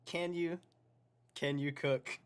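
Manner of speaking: high, loud, incredulous questioning; sceptical challenge. Real, not acted. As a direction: challenge the boast in pointed disbelief. Challenges the vlogger's boast.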